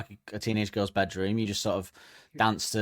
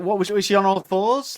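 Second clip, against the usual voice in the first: ordinary talk, nothing marked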